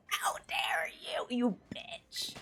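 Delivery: whispered fake fury; whispered mock curse. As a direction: hiss it under the breath.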